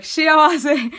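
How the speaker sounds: high, loud blurt as if just remembering; acted burst of relieved recognition; imitating sudden recall. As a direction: mimic someone triumphantly reading the forgotten word. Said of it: "looks down at the prompter and he was like".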